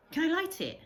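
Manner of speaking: high, loud eager asking; excited boy pleading; eager plea. Acted. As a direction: beg to be allowed, bursting with eagerness. Boy begging to light beacon.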